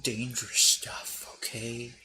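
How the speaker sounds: hushed warning whisper. Acted. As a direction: whisper it like a secret.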